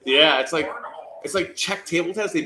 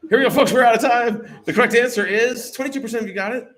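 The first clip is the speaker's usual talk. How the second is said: loud, high, fast-moving call; host announcement; 8 semitones higher, 8 dB louder, faster than usual. Real, not acted. Host calls time on the question, loud announcement. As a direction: rattle out the result at full volume.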